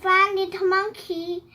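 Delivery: plain reading voice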